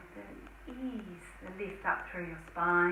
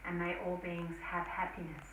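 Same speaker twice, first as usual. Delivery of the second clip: prayer; 4 semitones lower than usual. Acted. Om and dedication prayer.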